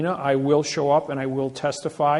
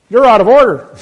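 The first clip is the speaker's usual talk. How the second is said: much higher, very loud mock judge shout; judge's retort; 8 semitones higher, 17 dB louder than usual. Judge perks up and scolds him back, loud high shout. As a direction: fire it back like an indignant judge. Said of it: "Then the judge perks up and says".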